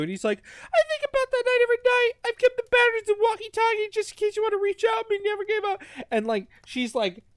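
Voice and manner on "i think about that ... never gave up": very high, fast hammed-up weepy voice; mock tearful. Retelling a tearful walkie-talkie confession, mock crying. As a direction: gush it in a high, monotone squeal. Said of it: "starts crying".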